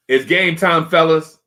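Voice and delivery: loud, high pump-up call; rally the team, loud; hype call. Retold or imitated. Trainers hyping up the team.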